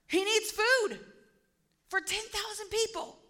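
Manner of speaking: high-pitched, hammed-up dismay; mock alarm. Preacher as Philip running to the other disciples, mock alarm. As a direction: act out alarm, pitched well up and stretched out.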